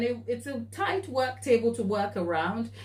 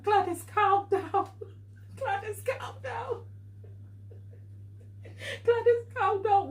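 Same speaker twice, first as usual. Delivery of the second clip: much higher, teary voice telling herself to calm down; half-laughing, half-crying self-pep talk; tearful self-soothing; 12 semitones higher, 3 dB quieter than usual. Retold or imitated. Baker retelling how she calmed herself beside the ruined cake, high and teary. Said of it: "I put my hand to my chest and I went".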